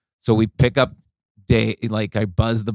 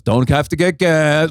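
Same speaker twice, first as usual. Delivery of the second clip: slow, louder, clipped instruction; comic barking orders at the drivers, slow and impatient; curt impatient order; 3 semitones higher, 5 dB louder, slower than usual. Retold or imitated. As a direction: lay down the rule with no patience. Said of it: "He goes".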